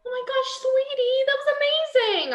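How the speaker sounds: mother gushes over her daughter's comeback; thrilled praise. Acted. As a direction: burst with pride.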